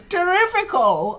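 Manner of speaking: high, loud rapturous shout; Aunt Sponge crying out. A narrator's reading. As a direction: cry out in triumph. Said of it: "Aunt Sponge cried out".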